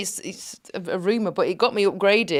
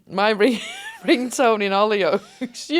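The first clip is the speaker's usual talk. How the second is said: usual speaking voice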